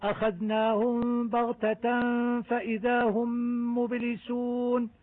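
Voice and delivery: slow chanted recitation, the mim sounds clear; Quran recitation. Shown as a demonstration. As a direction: recite the verse, marking each mim. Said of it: "أحكام الميم الساكنة في آية واحدة". (تلاوة مجودة توضح أحكام الميم)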